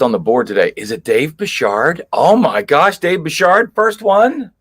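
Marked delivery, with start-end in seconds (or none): 2.1-4.5 excited. Real, not acted. Surprised Dave is on first.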